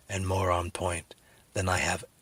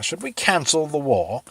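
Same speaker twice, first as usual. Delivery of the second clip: higher put-on courtier voice; 8 semitones higher, 4 dB louder than usual. Courtier asking the king about the war, higher put-on voice. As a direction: ask like a timid advisor. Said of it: "everyone around him".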